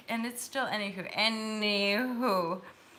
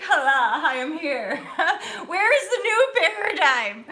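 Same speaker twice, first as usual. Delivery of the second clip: high, loud, laughing cry of arrival; laughing mock arrival; 7 semitones higher, 9 dB louder than usual. Retelling arriving at Piccadilly Circus, high, loud and laughing. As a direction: ham up your younger self landing full of hope.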